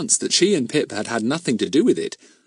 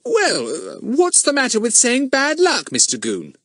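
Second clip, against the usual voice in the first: much higher, mock-injured innocence; aggrieved. A narrator's reading. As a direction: act wronged. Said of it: "said Fatty in an aggrieved voice".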